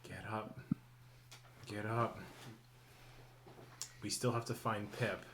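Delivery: muttering. Acted. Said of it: "he's just kinda muttering".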